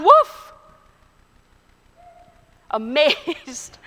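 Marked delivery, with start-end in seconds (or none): 0.0-0.5 sharp high yelp of a woof; dog bark. A narrator's reading. Dog answers the butcher again.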